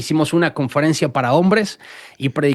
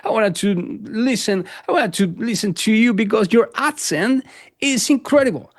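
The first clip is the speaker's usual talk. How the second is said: quoting the American pastor; 5 semitones higher than usual. Retold or imitated. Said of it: "predica el domingo y me dice".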